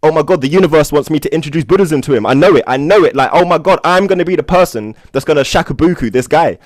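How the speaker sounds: the universe is handing you a mission; excited. Retold or imitated. Thrilled about meeting Toynbee's brother-in-law, loud and high. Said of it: "you can see by my excitement".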